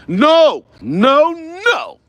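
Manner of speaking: usual speaking voice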